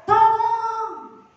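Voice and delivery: high, drawn-out call for help; imitated wail of someone wanting rescue; mock cry for help. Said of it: "dia teriak".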